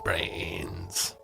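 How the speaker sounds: low, slow zombie moan; zombie groan. Shown as a demonstration. Zombie voice bit.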